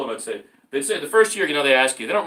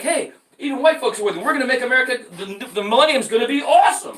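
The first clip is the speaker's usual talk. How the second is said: giddy optimism; 9 semitones higher, 5 dB louder than usual. Retold or imitated. His hopeful past self.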